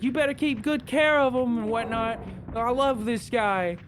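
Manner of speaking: high, fond imitation; doting Abby voice. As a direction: fuss over the dog in a high voice. Abby fussing over beloved dog.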